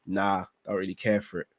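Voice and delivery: lightly voiced lazy husband, low; a hint of an idle husband shrugging it off; dismissive husband. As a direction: brush it off like you can't be bothered, low. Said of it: "I turn around and I go".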